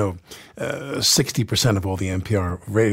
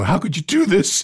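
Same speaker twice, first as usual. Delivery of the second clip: high, tearful, overwrought plea; tearful overacting; 9 semitones higher than usual. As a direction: overdo the tears on the line. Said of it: "the tears are rolling down my face".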